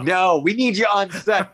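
loud, slow call to set; crew calling. Retold or imitated.

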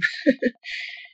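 short breathy nervous laugh; a quick self-conscious chuckle. Real, not acted. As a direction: laugh off the jitters at the mic. Nervous laugh mid-share.

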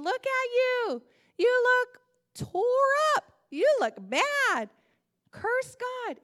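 high, loud, drawn-out taunt; jeering friends. Retold or imitated. Job's family and friends mock his sores, loud high taunting. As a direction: imitate scornful relatives with loud, high jeers. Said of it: "his family and friends were saying".

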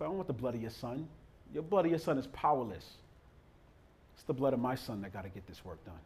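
quiet, low answer from God; hushed God. Acted.

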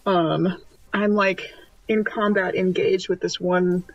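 ordinary talk

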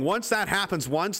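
ordinary talk, nothing marked

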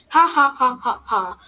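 ha-ha like a cartoon bad guy; mock evil laugh of the bully shark. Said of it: "with an evil laugh".

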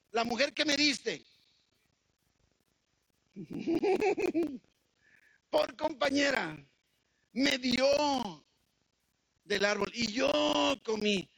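high, pleading excuse, a laugh in the middle; a guilty man pointing at his wife; whiny Adam. Retold or imitated. Adam keeps blaming, laughing.

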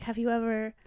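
soft, breath-light mock Italian accent; hushed, lightly imitated Italian seducer. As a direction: murmur it like an Italian romantic lead. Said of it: "he's got an Italian accent".

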